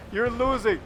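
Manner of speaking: normal talking voice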